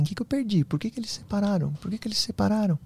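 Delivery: high, soft, plaintive re-enacted questions; retold bewildered questioning. Man replays asking spirits about the breakup, softly bewildered. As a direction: ask it high and small, as if lost.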